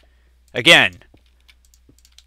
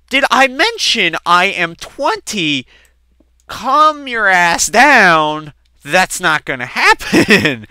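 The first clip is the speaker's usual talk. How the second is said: higher and louder, slowing right down; exasperated protest; 6 semitones higher, 7 dB louder, slower than usual. Retold or imitated. Son telling his dad to calm down, loud and exasperated. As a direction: spell it out to an overeager dad.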